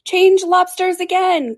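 high, loud, drawn-out shout; top-of-the-voice cry. A narrator's reading. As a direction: yell the dance call long and high. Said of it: "cried the Griffin at the top of his voice".

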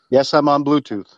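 ordinary delivery

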